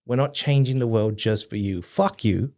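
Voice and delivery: ordinary talk, nothing marked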